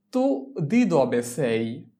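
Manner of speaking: drawn-out, careful Italian; slow model phrase. Shown as a demonstration. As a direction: say it slowly so students can copy. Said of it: "teacher models Italian dialogue line".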